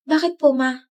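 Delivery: a gasp, then a fearful question; gasping worry. Acted. Daughter asks mother about father, gasping fear. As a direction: catch your breath in alarm. Child gasps, senses bad news.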